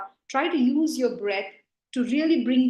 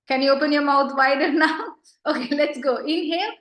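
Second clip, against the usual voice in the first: normal talking voice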